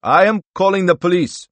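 high, loud character voice; cornered man threatening, loud and high; indignant announcement. A narrator's reading. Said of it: "Strobel stated".

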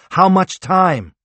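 slow, loud, high repeated shout; yelling while running away. A narrator's reading.

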